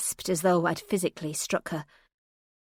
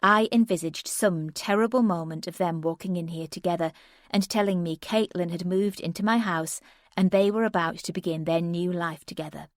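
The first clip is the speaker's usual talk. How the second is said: plain reading voice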